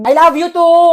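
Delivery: high, loud shouted reply; loud enough to hear. Acted. Friend told they can't be heard, shouting it back. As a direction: belt it back so it surely carries. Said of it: "di ko marinig".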